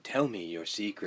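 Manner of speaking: low, soft seductive machine voice; sexy voice. Retold or imitated. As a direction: imitate a sultry phone-line voice. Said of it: "it's a rather sexy voice there".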